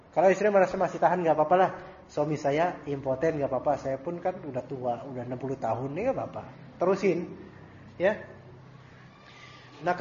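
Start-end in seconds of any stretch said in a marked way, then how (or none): 2.1-8.2 put-on resigned old wife. Voicing an old wife accepting it.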